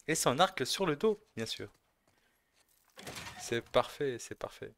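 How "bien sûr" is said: ordinary delivery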